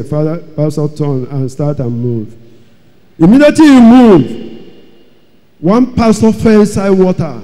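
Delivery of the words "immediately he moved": higher, louder and fast; dramatic burst. A narrator's reading. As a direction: blurt it out as the big moment. Dramatic climax of the story.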